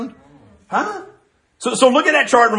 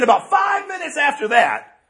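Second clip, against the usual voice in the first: drawn-out call; 7 semitones higher, slower than usual. Retold or imitated. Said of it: "He said".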